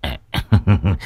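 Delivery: embarrassed low giggle; sheepish chuckle. A narrator's reading. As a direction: chuckle guiltily. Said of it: "cười hì hì".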